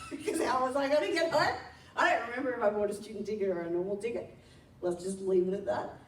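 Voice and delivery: ordinary talk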